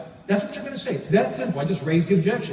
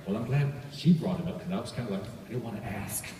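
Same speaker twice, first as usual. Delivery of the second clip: quiet inner thought; 9 semitones lower, 8 dB quieter than usual. Retold or imitated. Relieved listener's private thought.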